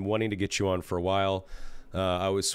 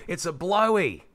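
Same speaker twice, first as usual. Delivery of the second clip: high, twangy mock Aussie; Australian accent; 8 semitones higher, 3 dB louder, slower than usual. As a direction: imitate a local explaining. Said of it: "this Australian guy was like".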